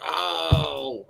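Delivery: high, drawn-out "oh" imitating viewers; retold pained reaction. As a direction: act out being caught off guard. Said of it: "and we were like".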